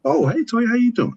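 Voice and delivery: high-pitched friendly hello; acted greeting. Acting out a simple friendly greeting.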